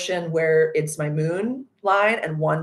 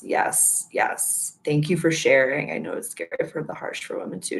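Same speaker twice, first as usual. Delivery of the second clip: ordinary delivery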